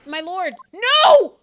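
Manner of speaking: drawn-out, high shouted objection; courtroom protest. Acted. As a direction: cry out in protest to the judge.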